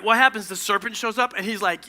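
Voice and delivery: usual speaking voice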